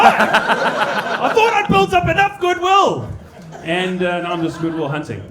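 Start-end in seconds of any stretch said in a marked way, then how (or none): 0.0-3.1 slow, high laughing cry; breaking up mid-line, slowed right down. Real, not acted. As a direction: laugh it out, much higher and louder. Laughing at own joke.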